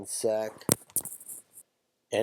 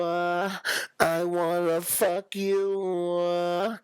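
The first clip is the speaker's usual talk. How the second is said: high, slow, auto-tuned voice; 7 semitones higher, much slower than usual. Retold or imitated. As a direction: croon it through auto-tune. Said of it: "do that shit like [name]".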